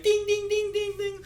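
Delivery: very high ringing dings; rapid high-pitched bell mimicry; imitated bike bell. Cyclist squeezing between pedestrians, imitated high bell dinging. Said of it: "there's a cyclist trying to come between".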